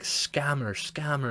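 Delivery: high-pitched mock accusation; mock calling out. Man points at the woman for tourists, high mock call.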